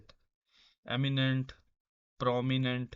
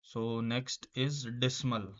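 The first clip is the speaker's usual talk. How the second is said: very slow, each word drawn out; slow dictation; much slower than usual. Shown as a demonstration. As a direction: spell it out slowly for the class. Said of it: "teacher reads word slowly". (शब्द को धीरे-धीरे खींचकर पढ़ना)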